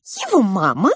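high, loud squeaky little mouse voice; pink mouse. A narrator's reading. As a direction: pipe up like a tiny mouse. Розовая мышь продолжает. (высокий голос мышки)

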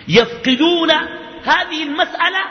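high, loud preacher's lament on يفقدون هذه المسألة; preaching outcry. Real, not acted. Preacher lamenting to the congregation, high and loud. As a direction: lift the voice sharply on يفقدون.

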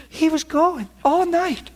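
high, slow wife's complaint; high voice quoting wife. Retold or imitated. Husband is gone, wife complains in a high, slow voice. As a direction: squeak it out slowly like the wife. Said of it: "She said".

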